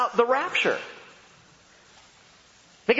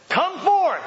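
commanding call; 14 semitones higher, 4 dB louder than usual. Acted. Jesus calls Lazarus from tomb.

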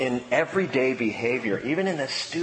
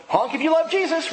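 high, sing-song teasing call; cheeky quip. Retold or imitated. To the angry driver through the window, high and teasing. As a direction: toss it off playfully, much higher. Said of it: "far enough to go".

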